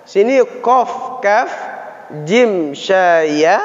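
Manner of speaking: kof, kaf, jim, sya, ya said slowly one by one with clear articulation; Arabic letter sounds. Shown as a demonstration. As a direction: spell out each letter slowly for the class. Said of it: "ingat-ingat aja posisinya".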